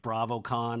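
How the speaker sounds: ordinary talk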